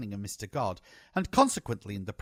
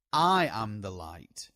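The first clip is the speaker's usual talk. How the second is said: "I" leaned on, slow and firm; stress on I; 2 semitones lower, 4 dB louder, slower than usual. Acted. Anna quoting Jesus to the narrator, slow with stress on "I". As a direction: land hard on "I", then ease through "am the light". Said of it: "She stressed the word I".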